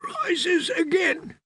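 very high, strained, drawn-out words; pained, choking speech. A narrator's reading. Coughing boy repeats the priest's rite, high, slow and pained. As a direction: struggle each word out slowly through pain. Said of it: "Every word was bought with pain".

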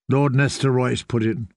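plain reading voice

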